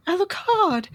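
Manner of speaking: a high mock call, pitched well up; calling after Alucard. Retelling the game, Maria calls out to Alucard, high. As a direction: call his name after him as he walks off. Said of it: "Maria Renard is like".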